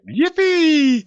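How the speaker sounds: put-on very high, bubbly Valley girl cheer; Valley girl squeal. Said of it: "You sound like a Valley girl".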